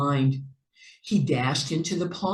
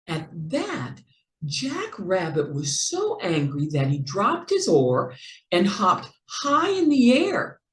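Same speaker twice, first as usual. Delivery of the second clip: usual speaking voice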